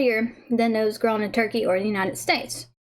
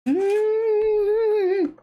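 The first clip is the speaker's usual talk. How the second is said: high, savouring mm; delighted; 10 semitones higher, much slower than usual. Real, not acted. Tasting the cake for viewers, high delighted savouring.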